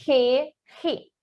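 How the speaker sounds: "Re, ri" with the R rasped from the throat; throaty Spanish G. Shown as a demonstration. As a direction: say "Re, ri" like the R of Portuguese rato. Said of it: "como se fosse um R".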